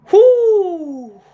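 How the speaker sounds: high, loud whoop of delight; excited whoo. Real, not acted. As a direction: cheer out loud, hyped. Excited cheer on stream.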